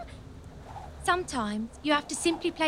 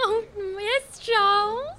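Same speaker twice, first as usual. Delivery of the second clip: flirty giggle; 7 semitones higher, slower than usual. Acted. Queen flirts with king.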